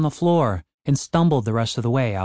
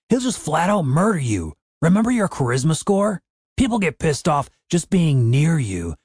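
higher, agitated warning; exasperated scolding; 4 semitones higher than usual. A narrator's reading. Dave warns Cooper off going back, exasperated. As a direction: scold him with pitch swinging up.

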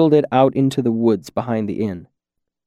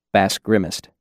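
usual speaking voice

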